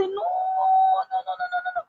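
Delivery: very high wailed string of no's; crying protest. Retold or imitated. Mother refusing as her baby is driven away, high crying wail. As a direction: wail it high, long first, then rapid and fading. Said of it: "I was crying".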